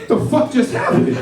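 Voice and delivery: high, airy, stunned whisper; a bewildered guest mid-ceremony, breathless and stunned; stunned disbelief. Retold or imitated. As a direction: gasp it out in shock at what just happened. Stunned reaction to kid's scream.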